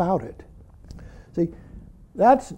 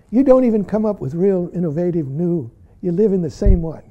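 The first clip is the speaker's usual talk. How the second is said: ordinary talk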